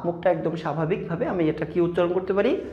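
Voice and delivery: ordinary talk, nothing marked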